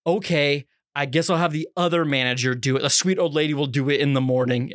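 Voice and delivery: usual speaking voice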